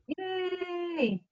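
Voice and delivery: a little classroom cheer of relief; bright mock-triumphant yay. Real, not acted. Cheering no stem changers.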